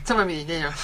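hint of charmed surrender; lightly voiced smitten man; mock seductive. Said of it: "y tú dices".

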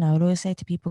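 ordinary talk

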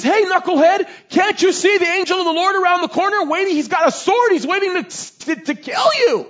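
high, loud exasperated talking animal; scolding donkey. Acted. Donkey warning its master of the sword-bearing angel, exasperated. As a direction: holler the warning as an indignant donkey. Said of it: "The donkey turns around and he says".

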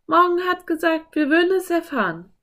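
put-on high, quick girl character voice; Anne. A narrator's reading. Anne reassures Philipp about the riddle, high quick voice. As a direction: answer quickly, pitched well up.

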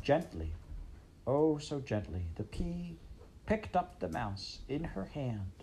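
soft and slow, tender; gently. A narrator's reading. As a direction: hush it as she lifts the mouse. Tender narration of the princess.

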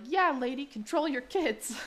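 put-on kid backing up a parent; Jean's heckling. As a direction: chime in high, drawing out the order. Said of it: "And Jean is like".

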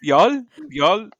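loud, high, repeated mock "y'all"; mocking y'all impression. Mimicking the show's endless y'alls to co-hosts, loud and high. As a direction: parrot the word loudly in a put-on high voice. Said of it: "Death by a Thousand Yalls".

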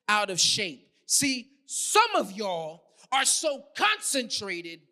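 emphatic preacher driving the point home; full-force preaching. Real, not acted. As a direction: preach it hard, landing on key words.

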